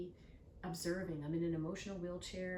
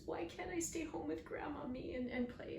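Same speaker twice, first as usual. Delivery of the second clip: put-on whiny child; 10 semitones higher than usual. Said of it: "And, and he's".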